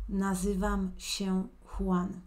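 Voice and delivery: nazywam się Juan said slowly and clearly; slow teaching demo. Shown as a demonstration. Teacher reviewing the answer with the student, slowly. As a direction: model the full answer at a slow pace.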